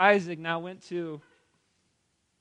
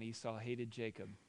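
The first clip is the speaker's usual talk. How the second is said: usual speaking voice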